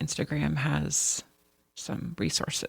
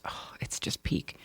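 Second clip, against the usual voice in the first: breathy hushed whisper, much softer; 9 dB quieter than usual. Real, not acted. Host gushing about a nineties mall slasher, whispered. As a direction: lean in and whisper it like a secret thrill.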